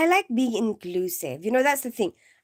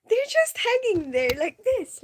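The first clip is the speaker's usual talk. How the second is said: high, louder, laughing through the words; laughing description; 10 semitones higher, 5 dB louder than usual. Real, not acted. Describing dancers hanging on poles, laughing. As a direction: giggle while you describe it.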